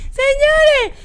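high, loud cry of disbelief; exasperated high shout; loud exclamation. Real, not acted. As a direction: call out to everyone, stunned. Exasperated call to the listeners.

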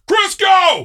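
high, loud holler across a room; yelled name. Retold or imitated. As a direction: imitate a man shouting out a name. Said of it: "he yelled out my name in front of the entire room".

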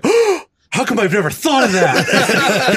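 high, loud, slow laughing quote; hammed-up amazement through laughter; amazed landowner. Quoting the hunting host's amazed reply, laughing.

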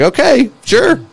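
fake-agree to brush him off; a high, sarcastic go-along; mock agreement. Mock agreeing with arrogant player.

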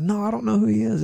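high-pitched, protesting denial; insistent denial. Retold or imitated. Peter pressed again by bystanders, insistent denial.

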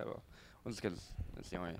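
trailing off. Real, not acted. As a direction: voice fades away mid-sentence. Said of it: "you just trailed off mid-sentence".

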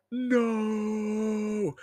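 long, high, drawn-out mock cry of anguish; angry wail. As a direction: wail it out in hammed-up fury and pain. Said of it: "all angry, and he's like".

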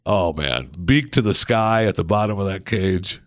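ordinary talk, nothing marked

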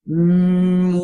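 steady resonant hum; sustained mm hum; humming. Shown as a demonstration. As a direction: hum into the facial mask. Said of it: "by humming like this".

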